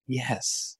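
very soft, small yes; tiny, distant-sounding admission. A narrator's reading. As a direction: answer faintly, as if from a long way off. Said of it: "Her voice was small now".

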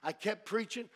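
ordinary talk

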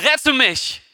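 höher gerufen, aber kaum lauter; schrie. A narrator's reading. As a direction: ein milder, vorgelesener Hilferuf. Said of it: "schrie er". (Petrus ruft Jesus um Hilfe, mild gerufen)

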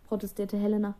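plain reading voice

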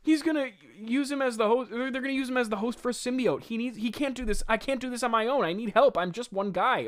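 high, breathless-run mock panic; lightly mocked overwhelmed hero; panicked aside. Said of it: "And he's like".

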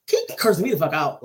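ordinary delivery

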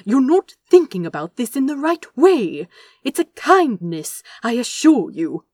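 loud insistent argument; heated pleading. Acted. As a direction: push back hard and loud. Angel argues back heatedly.

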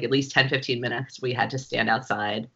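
usual speaking voice

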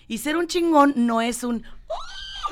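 sudden shouted outburst. Real, not acted. Father corrects himself mid-sentence with a sudden shout. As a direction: burst out and cut yourself off.

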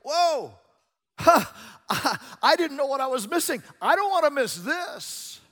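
high, shouted amazement; sudden realisation. Retold or imitated. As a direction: burst out as if sight just returned. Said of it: "suddenly it's like".

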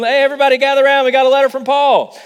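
imitating an eager crowd call, high and loud; excited Galatians. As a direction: call the crowd in, thrilled at the letter. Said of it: "picture the Galatians going".

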